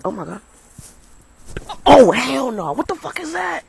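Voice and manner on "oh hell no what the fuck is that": high, loud burst of alarm; real startled alarm. Real, not acted. Speaker spots a huge bug mid-story, real startled alarm. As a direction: yelp in fright at something huge flying by.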